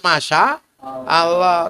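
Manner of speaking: very slow, stretched exclamation; slow pious gasp at a hot pan; drawn-out Masya Allah. Shown as a demonstration. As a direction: drag out the Masya like a habit of dzikir. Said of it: "Tangan megang barang panas".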